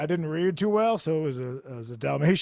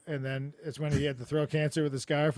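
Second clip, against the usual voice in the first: usual speaking voice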